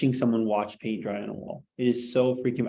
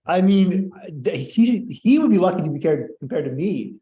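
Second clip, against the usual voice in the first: louder, higher hammed-up boast; mock arrogance; 6 semitones higher, 6 dB louder than usual. Teacher joking to the class that Plato should envy him, mock boastful. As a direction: puff yourself up as a joke.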